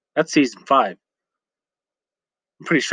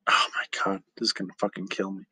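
whispering; 6 semitones lower, 8 dB quieter, faster than usual. Real, not acted. Excited aside.

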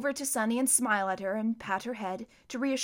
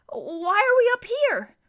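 put-on much higher, anxious boy's voice; alarmed boy; 13 semitones higher, 8 dB louder than usual. A narrator's reading. Klaus asking his sister, higher and alarmed. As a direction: ask it with rising alarm.

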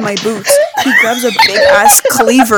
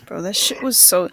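ordinary talk, nothing marked

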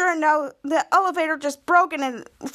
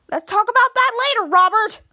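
higher, louder acted brush-off; exasperated brush-off; 8 semitones higher, 6 dB louder than usual. Kid Danger to Robert during the cable crisis, exasperated. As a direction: put him off urgently, pitched up.

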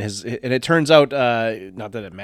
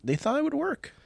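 much higher, put-on justification; high-pitched mocking excuse. Co-host mocks the nuke plan, high-pitched excuse. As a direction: voice jumps way up in mock defense.

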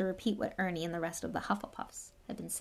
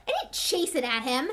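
louder, higher, trembling with fury; shaking with anger; 6 semitones higher, 6 dB louder than usual. Acted. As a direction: fight back the rage. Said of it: "his voice shaking with anger".

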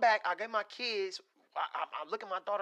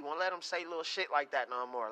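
normal talking voice

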